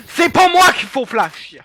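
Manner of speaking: high, loud yell of complaint; loud protest. Real, not acted. Player scolds teammate for flashing them, loud high shout. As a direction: shout your protest, dragging the words.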